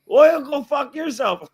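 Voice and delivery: high, loud, drawn-out mock outburst; angry Indian telemarketer. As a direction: imitate a furious caller with an Indian accent, slowly. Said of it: "this Indian guy's just going off on my cousin".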